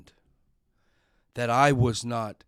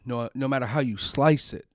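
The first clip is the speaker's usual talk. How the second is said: usual speaking voice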